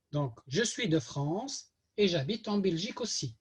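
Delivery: normal talking voice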